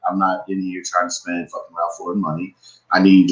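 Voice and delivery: ordinary talk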